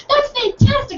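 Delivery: over-the-top eager exclamation; extra enthusiastic. Retold or imitated. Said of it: "I'm just a naturally enthusiastic person".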